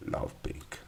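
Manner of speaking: whispered aside. Real, not acted. Aside while recolouring a line.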